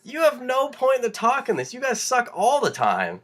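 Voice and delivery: a high, heated comeback to rival fans; heated retort. Retold or imitated. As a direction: fire back at the Vikings fans, voice pitched high. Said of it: "I'm like".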